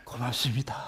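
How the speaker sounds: very low, soft thanks; acted humble gratitude. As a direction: offer hushed thanks before Jesus. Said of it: "그때서야 비로소 예수님 앞에". (낮고 작은 목소리로 감사하듯)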